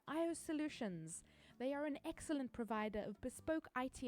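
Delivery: soft, even sponsor read. Acted. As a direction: read it like a polished ad. Reads a sponsor ad.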